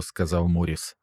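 ordinary delivery, nothing marked

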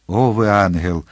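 plain reading voice

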